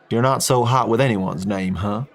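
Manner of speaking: a mild Texan ribbing; lightly voiced Texan jab; Texan teasing.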